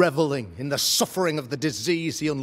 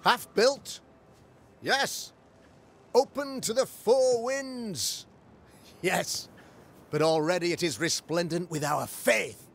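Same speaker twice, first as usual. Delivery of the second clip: much higher, rising rhetorical questions; fervent preaching; 6 semitones higher than usual. Acted. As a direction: lift the congregation with each answer. Preacher rhetorically answers own questions.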